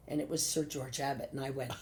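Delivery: ordinary talk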